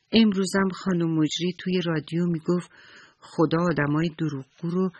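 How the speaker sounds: ordinary delivery, nothing marked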